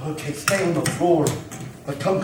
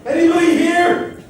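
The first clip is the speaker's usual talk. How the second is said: loud, high searching shout; calling out; 10 semitones higher, 7 dB louder, slower than usual. Acted. Fireman searching the rubble for more people, calling out.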